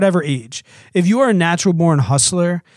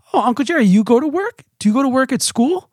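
put-on higher, sweet little-girl voice; three-year-old daughter; 8 semitones higher, faster than usual. Mom imitating her daughter asking Uncle Jerry, higher child voice. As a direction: lift the voice up like a curious toddler. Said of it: "she'll say things like".

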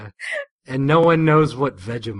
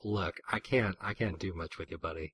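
much softer, lower aside; Ambulon, retold; 5 semitones lower, 13 dB quieter than usual. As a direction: brush someone off quietly and low. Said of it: "Ambulon is basically like".

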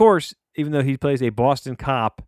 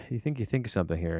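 ordinary talk

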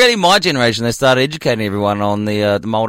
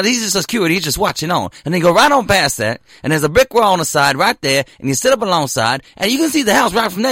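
lively Southern guard giving directions; southern accent; 7 semitones higher than usual. Retold or imitated. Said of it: "The southern one".

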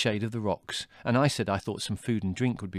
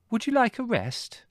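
put-on high girl voice. A narrator's reading. Margot voiced in story.

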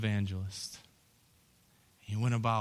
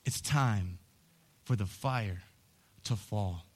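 soft, low, slow, spaced words; hushed and low; 5 semitones lower, 6 dB quieter, slower than usual. Acted. Preacher whispering the call to his congregation, hushed. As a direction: draw them in quietly before the build. Quiet setup before shouted repeat.

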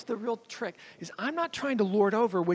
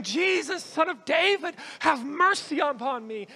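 high, raised plea for mercy; crying out; 7 semitones higher than usual. Retold or imitated. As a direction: imitate a desperate cry for help. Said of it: "they're crying out saying".

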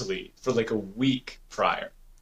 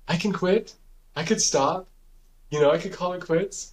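higher-pitched voice of temptation, lightly acted; tempting inner voice; 6 semitones higher than usual. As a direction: lightly voice a brain suggesting surrender.

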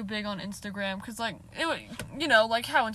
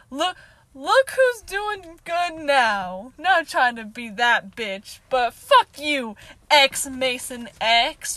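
defiant taunt; 2 semitones higher, 8 dB louder, much slower than usual. Real, not acted. Defiant taunt at the old rival.

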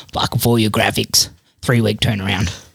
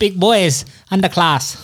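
higher-pitched mock Chinese accent; badly faked Chinese accent, pitched up; 7 semitones higher, slower than usual. Podcaster improvising gym-gear sponsor read, mock Chinese. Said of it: "the underclass in Chinese".

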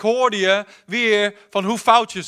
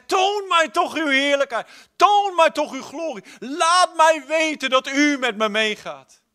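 much higher, impassioned begging; fervent plea; 8 semitones higher, 3 dB louder than usual. Acted. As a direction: cry out to God to show his glory. Moses begging to see glory.

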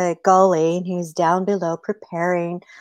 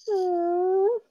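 high, soft little "hmm"; childlike whimper; 12 semitones higher, 6 dB quieter than usual. Acted. Rabbit answers like a hurt child.

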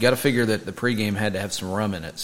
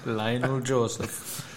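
Jamaican accent imitation; 4 dB quieter, much slower than usual. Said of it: "quoting any lines from Dan Aykroyd's character".

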